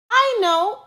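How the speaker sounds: high, bright-eyed know-it-all burst; eager exclamation. A narrator's reading. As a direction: blurt out the idea proudly. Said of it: "exclaimed one know-it-all".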